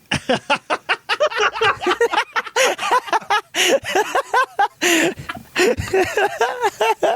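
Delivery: long squealing laugh; long high laugh. Real, not acted. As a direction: burst into laughter. Long laugh after joke.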